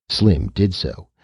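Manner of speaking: usual speaking voice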